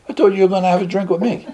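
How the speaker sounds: high, fast retold complaint; indignant protest. Patient confronting roommate who promised him a drink, indignant. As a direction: rush it out as a wronged, high-pitched objection.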